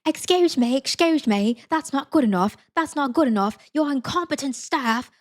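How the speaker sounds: mock an indignant British man in a bad accent; terrible British accent. British man complaining to park staff, bad British accent. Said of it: "doing a terrible British accent".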